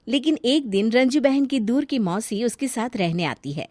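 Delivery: ordinary delivery, nothing marked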